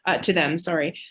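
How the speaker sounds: ordinary delivery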